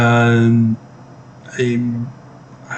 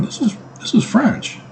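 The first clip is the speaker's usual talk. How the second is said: surprised realization; 9 semitones higher than usual. Retold or imitated. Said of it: "I'm going".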